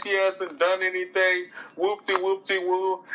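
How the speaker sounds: high, whiny mock wail of a panicked friend; mock hysterical rant. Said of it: "began to scream and yell".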